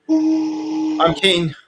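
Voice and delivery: high, slow, stretched out keen; drawn-out keen. Real, not acted. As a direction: sing out the word keen.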